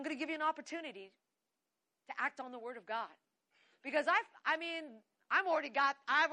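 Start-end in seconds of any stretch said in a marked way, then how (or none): none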